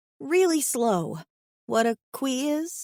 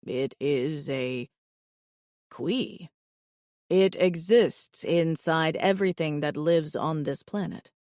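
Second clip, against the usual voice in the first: low, even alien voice; steady, low and earnest; calm alien suitor; 6 semitones lower than usual. Acted. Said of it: "puzzled again".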